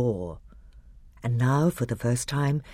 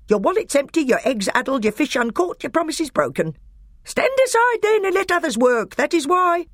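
much higher and louder taunting rant; shrieking higher dwarf contempt; mocking furious dwarf; 5 dB louder than usual. A narrator's reading. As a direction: hammer each insult home. Nick a Brick taunting.

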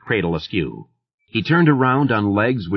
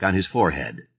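plain reading voice

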